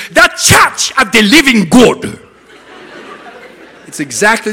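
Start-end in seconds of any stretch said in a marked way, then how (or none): 0.1-2.2 loud, high, slow African accent. Shown as a demonstration. Showing how it is said in Africa, booming African accent. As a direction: proclaim it with a strong African accent. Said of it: "So in Africa, that is".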